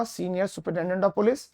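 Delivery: normal talking voice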